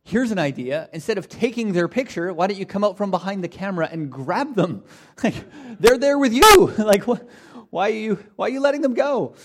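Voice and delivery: baffled stand-up outrage at the post office, laughing through it; Jerry Seinfeld bit. Retold or imitated. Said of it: "And, and he's like".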